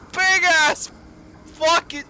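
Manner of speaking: excited emphasis. Real, not acted. Retelling the monster's size.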